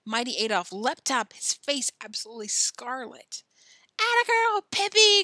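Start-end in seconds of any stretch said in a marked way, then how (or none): 4.0-5.2 high, loud cheer; excited cry. A narrator's reading. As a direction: cheer her on at the top of your voice. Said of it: "cried Tommy".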